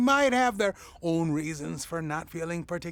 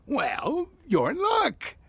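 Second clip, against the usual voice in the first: delighted burst; 9 semitones higher, slower than usual. Acted. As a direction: pounce on the chance with glee. Sudden delighted enthusiasm.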